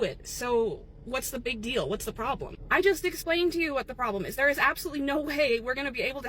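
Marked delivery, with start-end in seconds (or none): none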